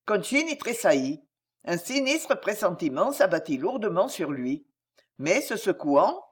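ordinary delivery

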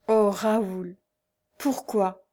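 slow lament with broken pauses; anguished, tearful reproach. A narrator's reading. Betrayed wife reproaching her husband, slow tearful anguish. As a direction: let each word fall, heavy with anguish. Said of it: "elle dit d'une voix lente et mal articulée".

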